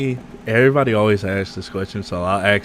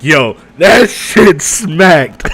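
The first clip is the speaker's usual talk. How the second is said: hyped excitement; 6 semitones higher, 9 dB louder, much slower than usual. Retold or imitated. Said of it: "I was just like".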